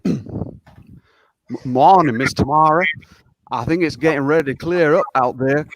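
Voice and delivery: put-on Southern US accent. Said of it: "try my best on this accent".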